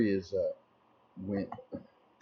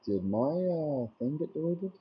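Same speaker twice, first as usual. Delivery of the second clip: surprised; 8 semitones higher than usual. Real, not acted. Surprised at deleted message.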